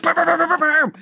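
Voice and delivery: high-pitched bam bam; spoken gunfire imitation; mock gunshots. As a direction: fire the words off like a gun. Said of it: "he's like".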